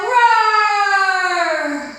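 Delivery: loud drawn-out roar; lion roar. Shown as a demonstration.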